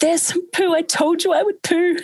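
high, squeaky mock panic over poo; panicked squeal. Mother in labour panicking to the midwife about pooing, high squeal. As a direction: squeal it like a terrified kid caught out. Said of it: "I was so terrified of pooping".

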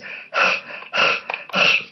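heavy breathless exhale; out-of-breath exhale. Shown as a demonstration. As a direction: blow out a big, winded breath. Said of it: "out of breath like this".

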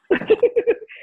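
bright burst of laughter; real laugh; high laugh. Real, not acted. Laughs after dad's adage.

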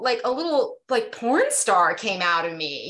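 ordinary talk

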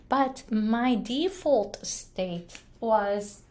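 normal talking voice

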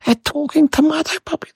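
high-pitched imitation of panicked kids; retold cry of alarm, pitched high; mock outcry. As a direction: cry out like a startled child, without shouting. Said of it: "They cried out".

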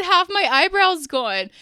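telling the waxer straight, loud and high; retold loud complaint. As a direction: announce the loss with a hammed-up blast.

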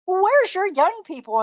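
high, drawn-out mock question; imitating baffled onlookers. Visitors seeing great-grandmas haul hay, imitated bafflement. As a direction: act out puzzled visitors calling out. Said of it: "and people are like".

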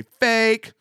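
scoffing. Pretend. Imagined listener dismissing the story, scoffing. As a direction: brush it off as made up.

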